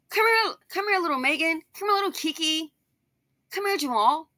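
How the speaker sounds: high-pitched coaxing call to little ones; calling children over. Acted. Father calls his kids over for testing, coaxing. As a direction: play a daddy summoning the kids, pitched well up.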